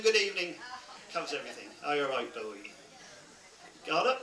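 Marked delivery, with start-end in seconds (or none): 1.8-2.6 quieter Norfolk greeting; Norfolk phrase repeated. Shown as a demonstration. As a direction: say it again in Norfolk, softer. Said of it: "That, that means good morning".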